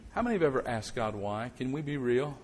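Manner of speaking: ordinary talk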